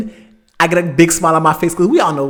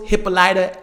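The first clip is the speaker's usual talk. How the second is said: ordinary delivery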